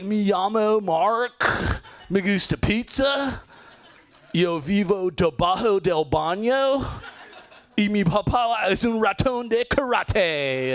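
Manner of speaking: a Ninja Turtle giving a class presentation; Ninja Turtle Spanish. Retold or imitated. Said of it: "sounds like a Ninja Turtle".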